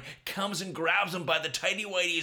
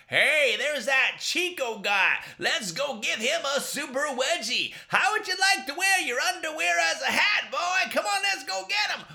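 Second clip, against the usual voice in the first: high, loud mocking bully shout; bully taunting; 10 semitones higher, 6 dB louder than usual. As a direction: jeer at the kid you're about to wedgie. Bullies threaten Chico.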